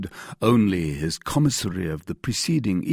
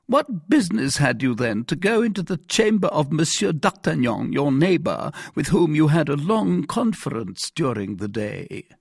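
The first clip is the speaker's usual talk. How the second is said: high, raised official questioning; interrogating commissary; 9 semitones higher, 5 dB louder than usual. Acted. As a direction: press the prisoner with an accusing question.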